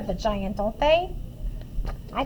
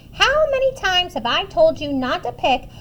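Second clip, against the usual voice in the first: higher-pitched cross mother; raised-voice telling-off; scolding yell; 6 semitones higher, 4 dB louder than usual. A narrator's reading. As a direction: scold like a fed-up mama. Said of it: "she yelled".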